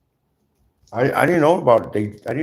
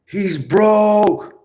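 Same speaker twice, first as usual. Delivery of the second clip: high, loud sneering gossip; mocking viewers who call him broke, high and loud; 10 semitones higher, 6 dB louder than usual. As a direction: sneer it like a shocked busybody. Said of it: "they be like".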